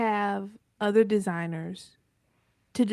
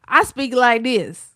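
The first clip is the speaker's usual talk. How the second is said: Southern twang; 9 dB louder than usual. Shown as a demonstration. Showing the AI how she really talks, loud Southern twang. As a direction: lean hard into her Southern accent, louder. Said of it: "all that Southern twang".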